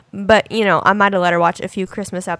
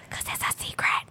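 whisper; 12 dB quieter than usual. Retold or imitated. Explaining daughter's secret rules.

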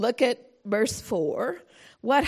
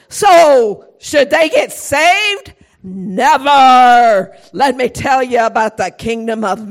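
loud, emphatic preacher's challenge; preaching loud; 14 dB louder than usual. Real, not acted. Preacher challenging the congregation, loud emphasis. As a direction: hammer it home to the congregation.

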